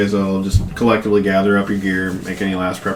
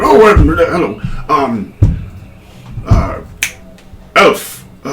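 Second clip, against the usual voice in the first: startled, higher and louder, then stumbling; flustered greeting; 8 semitones higher, 6 dB louder, slower than usual. Acted. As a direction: act caught off guard, fumbling for words. Startled character greets arriving party.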